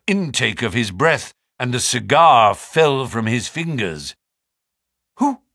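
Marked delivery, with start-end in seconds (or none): 5.2-5.4 high, halting question; shocked stammer. A narrator's reading. Naturalist sees the dead man's face, stammering. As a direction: stumble on the first word in shock. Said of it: "he stammered".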